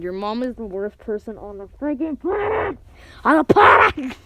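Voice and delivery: muffled scream into a pillow. Real, not acted. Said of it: "I'm screaming into my pillow right now".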